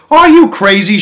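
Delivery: loud, high outraged cry; shout. A narrator's reading. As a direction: shout her down in disbelief. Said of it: "shouted a woman".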